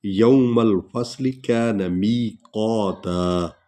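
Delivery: slow, मीम glided into लाम in यौमल; tajweed recitation. Shown as a demonstration. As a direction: recite slowly, joining the meem to the laam. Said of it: "मीम को लाम में मिलाएंगे". (धीमी तिलावत, मीम को लाम में मिलाकर)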